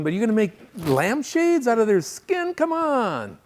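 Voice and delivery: high, exasperated comic protest; hammed-up disbelief at the absurdity; mock outrage. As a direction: climb into an appalled squeal. Mock outrage at lampshade killer.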